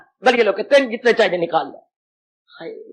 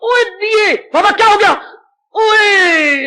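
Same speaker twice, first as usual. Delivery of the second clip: high-pitched startled outburst; flaring up; 7 semitones higher, slower than usual. Acted. Bullah Shah erupts as if punched in the chest, flaring up. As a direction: cry out high, as if struck. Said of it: "कुल्ला शाह साईं भड़क उठे".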